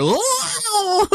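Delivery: high, drawn-out "ohhh" wail; overboard cry. Retold or imitated. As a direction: imitate someone yelling as they fall overboard. Acting out man going overboard.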